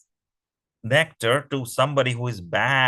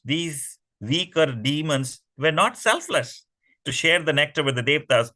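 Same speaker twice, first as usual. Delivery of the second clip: usual speaking voice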